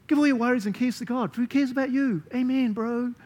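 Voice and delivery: high, loud, flippant mock cheer; breezy texter. A friend texting a verse to someone freaking out, glib and cheery. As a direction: toss the verse off like a glib text. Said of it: "fair verse to text to someone who's freaking out".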